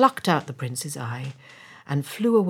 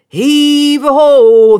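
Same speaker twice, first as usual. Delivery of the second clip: high, very loud drawn-out call; long full-voiced work cry; sailors' shout; 10 semitones higher, 18 dB louder, much slower than usual. A narrator's reading. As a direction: bellow it slowly like hauling sailors. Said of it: "they shouted as each chest came up".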